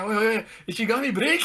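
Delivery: high warning cracking into laughs; laughing mock warning. Retelling Miyuki warning Ishigami, laughing and high.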